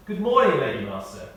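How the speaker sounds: usual speaking voice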